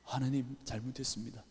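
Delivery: soft, broken apology to God; tearful confession. Retold or imitated. Pastor weeping at the altar, soft tearful apology. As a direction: confess in tears, very softly. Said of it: "눈물이 쏟아지는 거예요".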